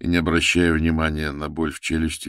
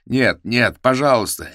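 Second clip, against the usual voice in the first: higher, louder crying no; sobbing plea; 8 semitones higher, 7 dB louder than usual. A narrator's reading. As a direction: plead through sobs.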